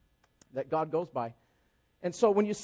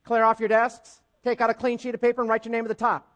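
louder, higher classroom command; imitated teacher; 4 semitones higher, 7 dB louder than usual. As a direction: announce it to the whole class like a teacher. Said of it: "the teacher says".

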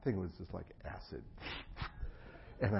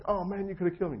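higher, louder aggrieved outburst; upset protest; 10 semitones higher, 7 dB louder, faster than usual. Retold or imitated. To the chiropractor, loud upset complaint. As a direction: complain loudly that it was dangerous. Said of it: "I was really upset".

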